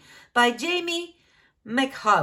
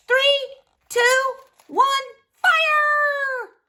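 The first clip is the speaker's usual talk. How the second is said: high, loud countdown to fire; thrilled cartoon countdown; excited countdown; 7 dB louder, much slower than usual. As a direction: launch it like a rocket. Bean countdown launching.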